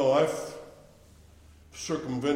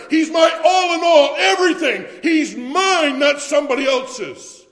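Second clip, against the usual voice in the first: much higher and louder, shouting "He's my"; preaching at full force; 7 semitones higher, 10 dB louder than usual. Acted. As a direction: build to an ecstatic shout of praise.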